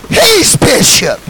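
drawn-out slow He's bishop; slow proclamation. Real, not acted. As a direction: stretch He's bishop slowly. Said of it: "slow proclamation".

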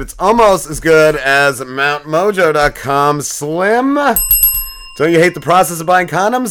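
upbeat, punchy podcast sponsor read; host pitching the sponsor to his co-host, upbeat ad voice. Acted.

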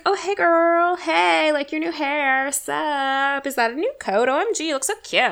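high, girly-chummy hello, hammed up; mock gushing greeting. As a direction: coo over a friend, mockingly. Mocks a gushing casual greeting.

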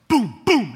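loud punched-out booms; imitated thumps. Imitating mattress punches to scare little brother, loud thumps. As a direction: boom it out like two heavy punches.

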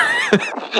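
high, breathy burst of real laughter. Real, not acted. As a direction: crack up helplessly mid-sentence. Cohost cracks up on air.

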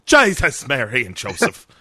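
exclaimed Irish impression, first word high and loud; hammed-up Irish oath; Irish accent. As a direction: burst out in a put-on Irish accent. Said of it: "your Irish impression".

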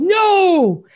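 high shouted no; crowd yell. Retold or imitated. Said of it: "everybody yells".

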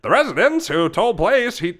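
high, loud newsreel announcer; hammed-up fifties news read; fifties newscaster. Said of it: "Put a fifties flair on it".

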